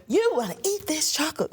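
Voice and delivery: high, pushy mock sales pitch; exaggerated, high-pitched announcer gush; overdone commercial read. Shown as a demonstration. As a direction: oversell it like a hammy chocolate ad. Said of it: "but you wouldn't say it like".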